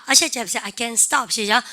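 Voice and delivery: ordinary delivery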